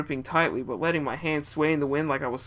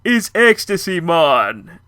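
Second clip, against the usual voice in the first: loud, lightly put-on Jamaican accent; hammed-up Jamaican guy drawling it; 2 semitones higher, 9 dB louder, much slower than usual. Tripping narrator hears his friend in a loud Jamaican accent. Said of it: "she sounded like a Jamaican guy going".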